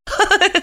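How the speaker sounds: a quick high laugh; short laugh. A narrator's reading. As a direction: let a light laugh slip out. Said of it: "Она рассмеялась".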